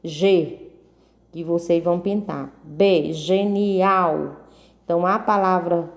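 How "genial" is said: "genial" slowly in three beats; slow, separated syllables in "genial". Shown as a demonstration. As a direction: stretch "genial" out as ge-ni-al for a class. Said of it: "eu pronuncio três vezes".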